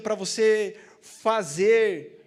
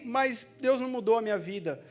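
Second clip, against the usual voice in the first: usual speaking voice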